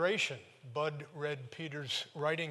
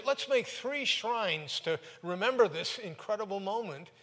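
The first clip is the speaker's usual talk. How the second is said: higher and louder, gushing with excitement; eager blurt; 6 semitones higher, 4 dB louder than usual. Retold or imitated. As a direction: blurt it out all in a rush of awe. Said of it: "Peter blurts out".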